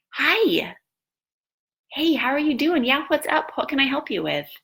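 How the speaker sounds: high, upbeat helper answering a call; bright phone greeting. Retold or imitated. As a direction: switch on a chirpy can-do voice for the caller. Cheerily answering phone despite having been weeping.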